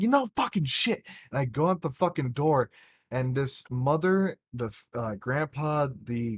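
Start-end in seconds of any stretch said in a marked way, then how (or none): 0.0-1.1 mock yell. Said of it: "I'm like".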